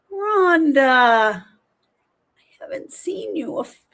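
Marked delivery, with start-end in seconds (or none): 1.4-3.8 breathy whisper. Real, not acted. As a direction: drop into a hushed whisper. Greeting returning viewer.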